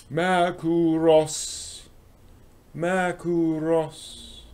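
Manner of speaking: Makuros stretched very slow, high and sung out as mah-koo-ross; drawn-out Makuros. Pretend. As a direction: savour Makuros slowly, high, like a funny mispronunciation. Drawn-out reading of the name.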